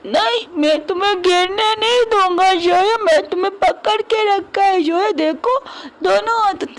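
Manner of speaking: put-on high, clingy drunk pleading; drunken earnest whine. Drunk man holding wife.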